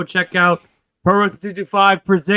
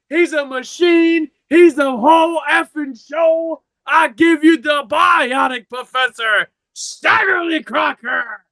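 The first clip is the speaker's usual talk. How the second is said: much higher, shouted, slow build-up; ring announcer hype; 9 semitones higher, slower than usual. Acted.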